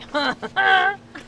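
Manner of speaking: high, loud mimicked laugh; imitated old man's laugh. Tour guide showing guests the ghostly recording, imitated old man's laugh. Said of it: "sounds like an old man laughing".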